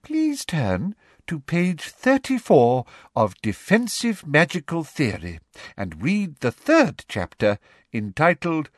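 high, slow, sickly sweet teacher; sugary Umbridge voice. A narrator's reading. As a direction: spell it out with patronising sweetness. Umbridge reads out instructions.

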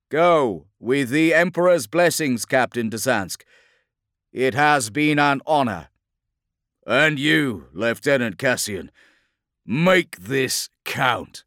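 loud, high, weighty address; rousing military send-off. Acted. Officers parting before the assault, rousing military send-off. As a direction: project a solemn farewell over battle noise. Officers' solemn battle farewell.

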